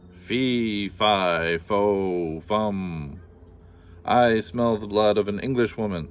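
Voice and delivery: loud, booming giant chant; menacing giant. Acted. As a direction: boom it out like a hungry giant. Giant's chant in closet scene.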